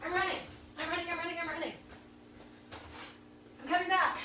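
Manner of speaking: breathless, high and fast; running commentary. Real, not acted. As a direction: chatter while sprinting off. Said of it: "Now I'm gonna sprint".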